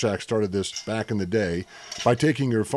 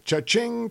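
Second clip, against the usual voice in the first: cash register sound; 11 semitones higher than usual. Acted.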